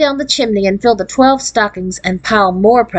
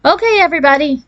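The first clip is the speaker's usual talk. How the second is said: quick, higher summoning call; father calling the family, lightly voiced; 7 semitones higher, faster than usual. A narrator's reading. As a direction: call them in briskly, pitched up. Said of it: "her father called".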